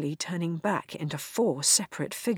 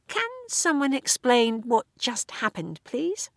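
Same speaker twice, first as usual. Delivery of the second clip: hesitant, high, unsure question; cautious; 7 semitones higher than usual. Acted. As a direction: ask carefully, half bewildered. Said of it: "Ruth asked cautiously".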